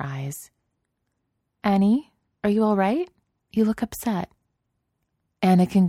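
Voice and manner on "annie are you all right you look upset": Padme's voice. Acted.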